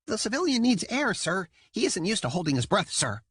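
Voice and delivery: high, crisp dutiful report; eager dolphin soldier. A narrator's reading. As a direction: rattle it off like a keen cadet. Jam reporting to captain.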